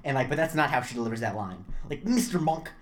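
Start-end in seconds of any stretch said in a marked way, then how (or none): 2.0-2.7 "Mister Monk" lifted higher and louder, in a light imitation of her; a light imitation of her calling out "Mister Monk"; Natalie saying Mister Monk. Fan imitating how Natalie says "Mister Monk", lightly voiced. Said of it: "is, like, the way I hear it in my head".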